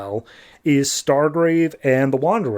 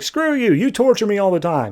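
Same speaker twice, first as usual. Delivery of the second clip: high-pitched, exasperated retort; mock angry outburst; 6 semitones higher than usual. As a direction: act out a sibling lashing out. Acted quarrel, Wanderer rejects sister.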